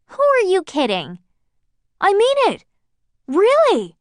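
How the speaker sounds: high, loud, outraged disbelief; indignant protest. Acted. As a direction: shout it at him in disbelief. Lorraine protests at John.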